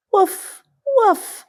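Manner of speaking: high yappy little puppy woofs; puppy bark. Acted. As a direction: bark like a tiny excited puppy. Puppy barks in story.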